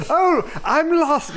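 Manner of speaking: high, mock-helpless voice, half laughing; put-on innocent high voice; helpless lost wanderer. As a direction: feign being lost, hammed up.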